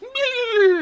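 very high, thin bleating whine; animal cry imitation. Dad teasing daughter with a goat noise, high animal cry. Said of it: "I know what a goat sounds like".

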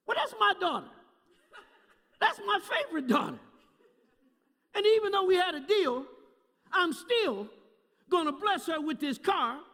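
high, insistent retold self-justification; acted fatherly pleading. Father excusing his favorite daughter, acted insistent pleading. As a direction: argue your way out of it, pitched high. Father acts out arguing himself round.

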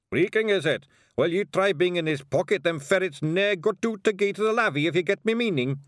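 angry little Scottish pixie; cussing Scottish pixie. Acted. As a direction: grumble in broad Scots as a tiny cross pixie. Second Feegle answers back.

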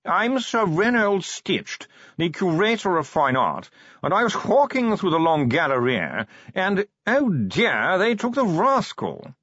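a fussy, high-class curator drawling slowly, words half yawned; a preoccupied chicken of a posh gentleman, dragging each word; posh yawning curator. Acted. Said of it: "It was not so much speech as modulated yawning".

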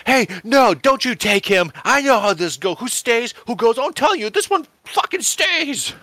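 put-on high, loud boyish defiance; a kid standing his ground with a gun; boy defying Death. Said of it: "Danny Madigan draws a pistol and says".

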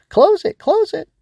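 tiny, very high, loud bug squeal; urgent high-pitched creature yelling; frantic small-voice cry. Acted. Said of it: "the voice cried out frantically".

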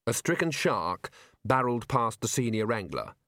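plain reading voice

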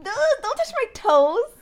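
loud, high, slow protest; alarmed shout. Real, not acted. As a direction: yelp it out in alarm. Feet touched during story.

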